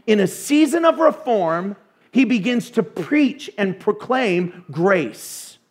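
ordinary talk

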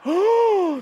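high, scandalized oh; exaggerated outrage; mock shocked gasp. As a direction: gasp like an offended fan. Mimics shocked audience members.

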